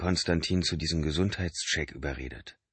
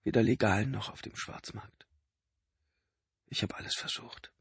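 sad; 5 semitones higher, 5 dB quieter than usual. A narrator's reading. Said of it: "sah ihn traurig an".

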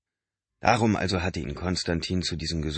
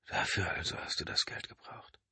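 whispered thought; 9 dB quieter than usual. A narrator's reading. Inner thought.

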